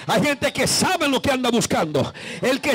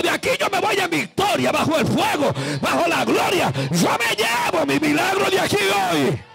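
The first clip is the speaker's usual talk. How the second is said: high, rousing cry of faith; victorious shout; 3 semitones higher than usual. Acted. Believer crying to God for victory, triumphant shout. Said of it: "alguien vino aquí tirando un grito".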